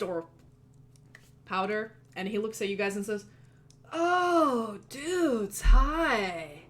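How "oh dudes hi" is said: high, loud, slack-jawed stoner greeting; laid-back dude. Acted. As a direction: drawl out a surprised stoner hello. GM greets players as NPC.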